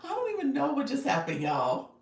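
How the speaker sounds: real cracking up, high and loud; rolling with laughter. Real, not acted. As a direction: laugh right through the words, pitch climbing. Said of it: "I'm rolling".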